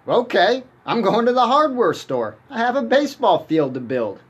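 high, loud and eager; excited. Acted. Ray tells his wife he's off to the hardware store, high and loud with excitement. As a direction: burst out with it, thrilled.

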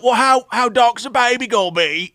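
high, put-on snooty question; mocking the royals. Royals asking about the baby, snooty. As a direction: voice the snobbish royals' worry. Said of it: "they're all like".

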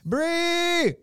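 "Brie" high, held long, dropping at the end; trying out "Brie". Shown as a demonstration. Host tries pronouncing an odd slang word, high drawn-out "Brie". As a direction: sing out a high, stretched "Brie". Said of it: "How do I pronounce this one?".

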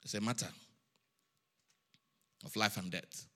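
very low, soft and grave; hushed warning. Real, not acted. Preacher warning the congregation of life and death, hushed. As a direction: drop right down for the weighty point.

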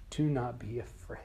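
tender whispered reassurance; gentle whisper. Acted. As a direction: whisper it to calm him. Jesus comforting fallen John.

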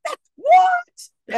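high-pitched, loud baffled squawk; mock a whole cast's incredulous outburst; scoffing squeal of disbelief. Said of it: "like, scoffing at moments".

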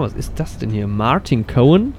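ordinary talk, nothing marked

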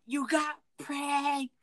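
high, very slow mocking sing-song; high teasing taunt about the prank; gloating taunt. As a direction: drag out the gloat, mocking her.

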